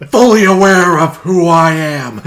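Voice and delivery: mock senator. Mock senator demanding loyalty.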